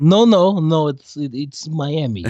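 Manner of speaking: insistent put-on Eastern European accent; mock Transylvanian accent. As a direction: protest in a hammed-up Transylvanian accent. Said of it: "like, Ukrainian or Transylvanian".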